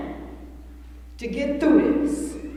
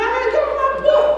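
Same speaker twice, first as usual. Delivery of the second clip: ordinary talk, nothing marked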